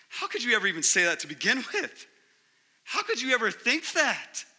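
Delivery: incredulous accusation. Acted. Husband re-enacting a marriage fight, incredulous accusation. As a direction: act out an exasperated spouse in a quarrel. Acted marital fight after a half-apology.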